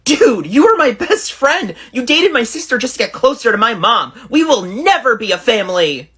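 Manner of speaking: indignant. Acted. Texter confronting friend who dated sister and mom, indignant. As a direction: protest as if betrayed by a best friend. Acted text quarrel over mom.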